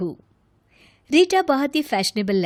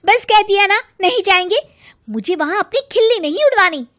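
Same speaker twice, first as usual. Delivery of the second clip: higher, louder, final-word snap; angry insistence; 8 semitones higher, 6 dB louder, faster than usual. Acted. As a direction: shut the argument down hard. Rita insists angrily.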